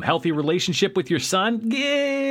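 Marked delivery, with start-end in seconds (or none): 1.6-2.3 high squeaky yeah into laughter; laughing. Acted. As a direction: burst out laughing on it. Mocking dad's healthy relationship.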